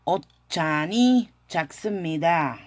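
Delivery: slow, careful Korean; slow model answer. Shown as a demonstration. Teacher models the Korean answer slowly for students. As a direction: Otchani chaksumida drawn out slowly. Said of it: "la respuesta sería".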